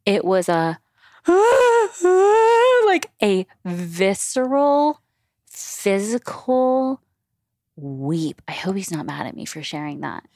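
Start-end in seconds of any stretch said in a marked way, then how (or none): 1.3-2.8 sudden high laughing cry. Real, not acted. To her co-host, recalling husband's weeping: overwhelmed high cry-laugh. Describing husband's visceral weep. 2.9-7.0 "visceral" and "physical" drawn out slowly, each pressed; slow emphasis. Real, not acted. To her co-host, describing husband's weeping: slow, pressed words. Stressing how hard husband wept.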